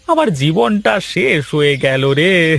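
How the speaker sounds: slow, hammed-up groan of despair; mock lament. Husband lamenting his primping wife, slow mock despair. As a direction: mourn your ruined life, slowly.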